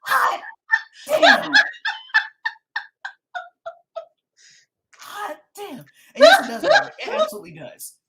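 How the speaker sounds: high, loud, laughing exclamations; laughing shriek. Real, not acted. Host startled by a spirit, shrieking and laughing.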